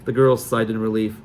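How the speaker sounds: usual speaking voice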